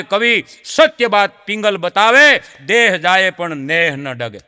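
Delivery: slow folk verse recitation; poem recitation. Acted. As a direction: chant the poet's lines with weight. Reciting Hindi couplet.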